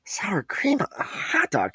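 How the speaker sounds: squeaky, half-voiced disgust; appalled disbelief. Recalling who would put that on a hot dog, appalled. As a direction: say it softly, slowly, voice cracking upward.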